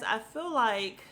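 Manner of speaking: usual speaking voice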